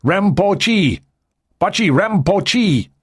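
lightly voiced Tibetan general declaring his name. A narrator's reading. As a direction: announce your name, proud and slow.

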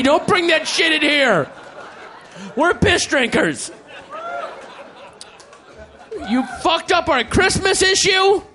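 mock outrage, half laughing; furious editor. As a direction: rage at the staff like a wounded boss. Editor raging at staff.

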